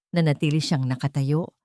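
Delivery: ordinary delivery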